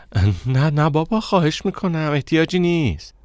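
high, flustered protest; flustered excitement. A narrator's reading. Nasrin offered a birthday song, excitedly flustered refusal. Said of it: "از هیجان دست و پاشو گم کرده بود".

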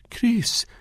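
high, whispered gasp of a name; faint, airy high-pitched cry; near fainting. A narrator's reading. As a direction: call out breathlessly, about to faint. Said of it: "نزدیک بود قش کند گفت".

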